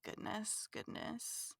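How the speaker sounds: breathy whisper, almost voiceless; whispered goodness. Real, not acted. Groaning at the goose pun in a whisper. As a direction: sigh the words out under breath. Sighing after goose joke.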